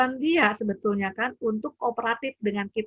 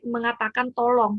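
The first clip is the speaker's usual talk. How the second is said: ordinary talk, nothing marked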